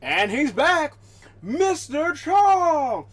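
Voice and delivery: loud, high, drawn-out welcome; excited announcer greeting. Real, not acted. Streamer welcoming his cat on stream, loud and high.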